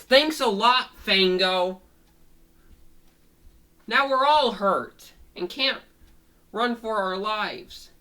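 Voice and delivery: high, loud complaint in Dog's voice; sarcastic blame. Acted. Dog blames Fango for everyone getting hurt, loud complaint.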